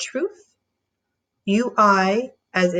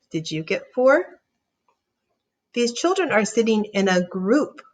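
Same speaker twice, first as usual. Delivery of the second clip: ordinary delivery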